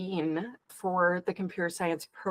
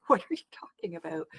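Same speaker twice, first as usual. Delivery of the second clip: breathless whispered disbelief; hushed, stunned whisper of disbelief; incredulous whisper; 4 dB quieter than usual. Retold or imitated. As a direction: whisper it like you can't believe what you heard. Incredulous reaction to low pay.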